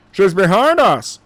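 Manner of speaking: soldier's mild shouted warning; shouting soldier. Acted. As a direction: call out the alarm to the squad. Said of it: "shouted another soldier".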